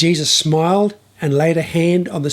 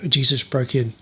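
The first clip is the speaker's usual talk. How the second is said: plain reading voice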